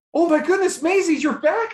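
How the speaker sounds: high, loud joyful cry of recognition; loud amazed delight; overjoyed exclamation. Retold or imitated. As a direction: re-enact a thrilled reunion, pitch leaping up. Retold joy at seeing lost child.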